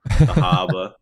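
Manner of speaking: dropped R Boston voice; exaggerated Boston accent. Shown as a demonstration. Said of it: "I can start going over my R's".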